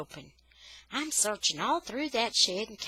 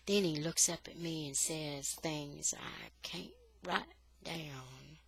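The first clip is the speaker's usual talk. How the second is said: plain reading voice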